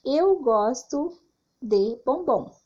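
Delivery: slow, lifted "Eu gosto" then falling "bombom"; slow model sentence, "bombom" falling to a stop; statement example. Shown as a demonstration. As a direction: read it as a clear statement ending with a full stop. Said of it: "Por exemplo".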